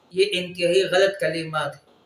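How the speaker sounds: normal talking voice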